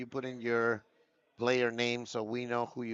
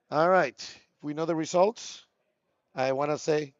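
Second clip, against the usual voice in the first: louder, higher play-by-play announcer call; loud caster call; 5 semitones higher, 8 dB louder than usual. Acted. Caster announcing the match results to the stream, loud.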